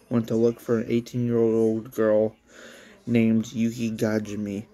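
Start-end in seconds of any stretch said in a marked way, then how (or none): none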